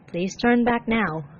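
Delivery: plain reading voice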